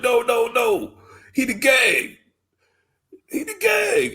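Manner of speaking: high, rising mock protest building to a shout; high-pitched cry of disbelief; mock outraged yell. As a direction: yell it like a betrayed fan. Mock outrage at plot twist.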